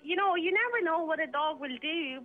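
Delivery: ordinary delivery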